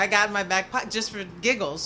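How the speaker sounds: ordinary talk, nothing marked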